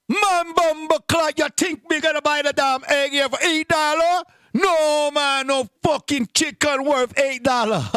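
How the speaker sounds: mock Jamaican accent, outraged at the price. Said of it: "I saw a Jamaican man trying to buy eggs".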